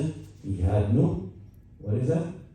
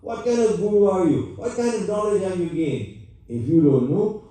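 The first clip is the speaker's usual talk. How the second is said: yelling angrily; 8 semitones higher than usual. Retold or imitated. Brahman yelling at the guru who had no answer, angrily. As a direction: hurl the questions at him. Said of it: "He yelled there".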